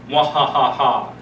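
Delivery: slow, hammy villain cackle; an evil mwahaha over a secret plan; mad scientist laugh. Acted. Teases the Spirit Week surprise.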